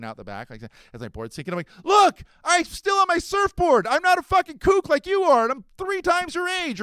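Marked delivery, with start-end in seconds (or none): 1.8-6.8 high, loud triumphant yelling; gloating shout. Retold or imitated. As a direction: shout it in the kid's face.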